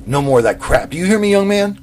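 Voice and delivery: loud, high furious scolding; angry scolding. A narrator's reading. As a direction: bark it at the boy. Angry director scolding boy.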